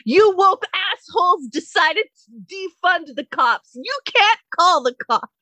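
high-pitched, hammed-up outrage; mock indignant rant. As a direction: imitate a neighbor railing at everyone. Imitating ranting neighbor threatened with police.

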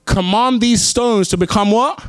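slow, high loud prompt; prompting question. Acted. Preacher prompting the congregation to finish, high and loud. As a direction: pull the answer from the room.

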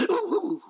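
high-pitched "ooh, ooh" hoot; mock church whoop. Preacher mimicking Sister Doodad reacting to preaching, high mock hoot. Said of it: "every now and then she goes".

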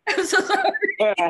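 laughing apology. Real, not acted.

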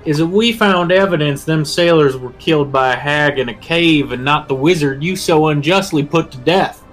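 put-on goblin shouting in Appalachian accent. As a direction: proclaim it to the crowd, Appalachian twang. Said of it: "for a little guy with an Appalachian accent as a goblin".

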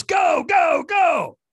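loud, high repeated shout; shouted urging. Retold or imitated. Trainer sending the firewalker off, shouted urging. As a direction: launch them with a full shout.

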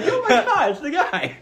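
very high mock squeal, laughing; imitated starstruck fan. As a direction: shriek like a fan spotting a celebrity. Acts out fans recognizing him.

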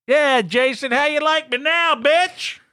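high mocking taunt. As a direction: rub it in his face. Taunting a listener.

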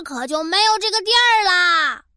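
ordinary delivery

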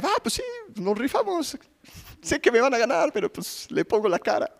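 hint of a playful tough-guy voice; mock brave volunteer. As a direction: toss it off like a shrugging daredevil. Imagined brave volunteer quoted.

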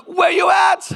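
high-pitched, frantic phone cry; acted-out freaked-out yelp, pitch shooting up; retold panicked demand. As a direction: blurt it out in mock panic. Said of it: "I'm scared right now".

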